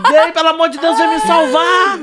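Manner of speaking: high mock wail, stretched at the end; retold desperate plea. Nephew begging aunt to save him, high desperate wail. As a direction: beg hammily to be rescued.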